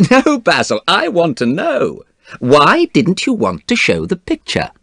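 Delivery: high-pitched, amused insistence; laughing. A narrator's reading. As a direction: press him, lightly amused. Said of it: "Dorian laughed now".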